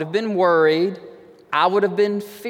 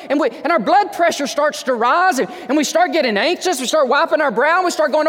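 climbing higher and louder, faster; preacher building to a fever pitch; rising agitation; 9 semitones higher, 5 dB louder than usual. Acted. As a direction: work yourself up into a panic.

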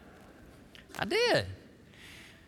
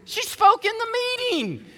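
high, loud, flustered denial; emotional protest; 12 semitones higher, 9 dB louder, slower than usual. Real, not acted. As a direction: blurt it out, overcome with emotion.

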